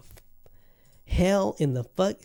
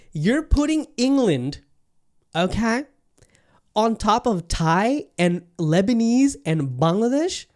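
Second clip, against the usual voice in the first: incredulous; 7 semitones higher, 5 dB louder than usual. Real, not acted. Mock outraged at list makers.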